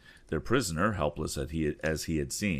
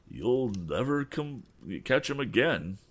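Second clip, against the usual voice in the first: higher, slow doom-laden warning; gloomy prediction; 7 semitones higher than usual. Acted. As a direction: warn them it's hopeless. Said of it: "predicted Pete".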